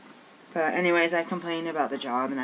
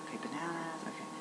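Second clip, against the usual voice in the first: whispered to herself; 4 semitones higher, 9 dB quieter than usual. Retold or imitated. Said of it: "I was just like".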